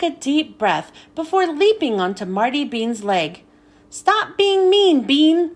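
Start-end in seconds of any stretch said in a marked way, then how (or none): 3.9-5.5 slow, loud, high shout; yelling at the top of voice. Acted. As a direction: yell it out as loud as you can. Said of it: "I yelled at the top of my voice".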